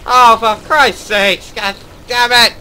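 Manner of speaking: frantic high shouting. Real, not acted. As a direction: scream at the fleeing enemy. Frantic chase, enemy escaping.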